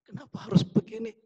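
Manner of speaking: high, soft crying-out to God; mock tearful plea. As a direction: imitate a tearful complaint in prayer. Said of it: "Waktu engkau nangis".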